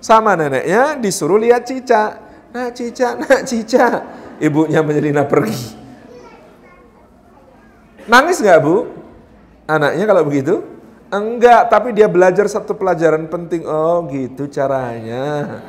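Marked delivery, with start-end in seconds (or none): 2.5-4.0 put-on high sing-out to a toddler; higher, playful luring call; distracting a child. Grandmother distracting child, acted. 13.7-15.6 slow, drawn-out dawning "oh"; lower, stretched-out scheming realisation; knowing realisation. Acted. Child's slow realisation, acted.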